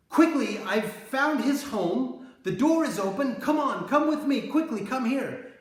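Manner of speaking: loud, high urgent yell down the road. Retold or imitated. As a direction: call out to an accomplice, loud and high. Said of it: "she yells".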